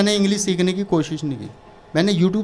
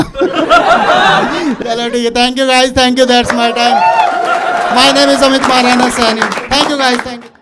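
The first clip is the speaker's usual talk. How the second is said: loud stage sign-off; 6 semitones higher, 5 dB louder, much slower than usual. Real, not acted. Comic signs off stage.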